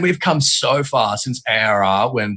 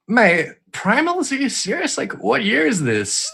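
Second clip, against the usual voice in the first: exasperated disbelief; 11 semitones higher, slower than usual. Retold or imitated. Retelling their own exasperated reaction.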